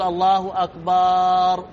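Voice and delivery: أكبر ending in a long held "baaar"; slow "Allahu akbaaar", holding the last vowel of أكبر; stretched ba. Shown as a demonstration. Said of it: "يمد الباء". (مد باء "أكبر")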